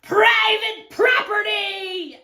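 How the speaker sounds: slow, high, forceful shout; angry yell. Acted. Cactus to intruding tortoise, yelling. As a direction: warn off a trespasser at full volume. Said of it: "yelled Hank".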